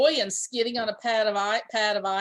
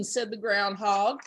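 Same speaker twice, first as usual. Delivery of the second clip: plain reading voice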